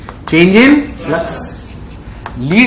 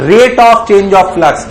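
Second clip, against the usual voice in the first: slow deliberate definition; much slower than usual. Shown as a demonstration. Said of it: "teacher repeats the definition".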